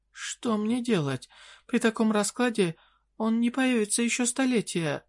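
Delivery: high desperate queen voice; pleading fairy queen. Acted.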